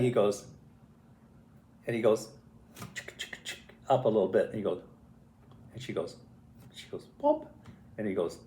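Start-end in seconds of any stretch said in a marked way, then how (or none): 2.9-3.6 voiceless rhythmic creeping noise; soft ticking "chick" for little steps; imitated scuttling. 7.2-7.5 quick little mouth pop; imitated popping. Imitates grape being popped in.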